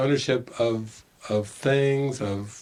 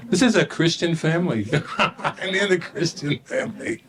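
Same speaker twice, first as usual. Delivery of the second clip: laughing, higher, disbelief; 7 semitones higher than usual. Real, not acted. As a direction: laugh through the irony. Laughing at his own story.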